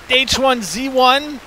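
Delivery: high, slow cursing of the game; exasperated lament. Real, not acted. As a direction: drag out the name in frustration. Cursing the game after death.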